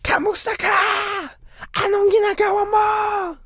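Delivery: very high strained shout, held back in volume; mock yell. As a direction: holler it as if hyping a crowd. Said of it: "I will yell this in the airport".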